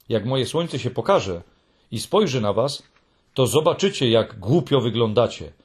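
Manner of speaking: plain reading voice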